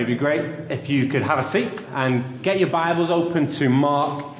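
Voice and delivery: usual speaking voice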